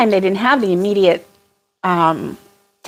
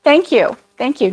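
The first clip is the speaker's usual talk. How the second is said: usual speaking voice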